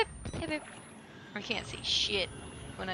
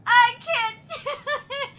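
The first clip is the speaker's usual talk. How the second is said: laughing despair; 9 semitones higher, 7 dB louder than usual. Real, not acted. Player failing, laughing helplessly. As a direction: laugh through the defeat.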